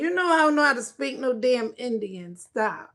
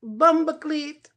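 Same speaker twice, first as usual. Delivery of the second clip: slow, louder Jamaican bumbleclat; Jamaican exclamation; 3 semitones higher, 5 dB louder than usual. Pretend. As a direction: draw out bumbleclat in a Jamaican accent. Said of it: "He said".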